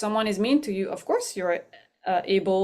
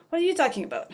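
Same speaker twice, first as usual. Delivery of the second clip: much higher, indignant comeback; defensive retort; 8 semitones higher, much faster than usual. Retold or imitated. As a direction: bristle at your partner. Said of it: "I got defensive".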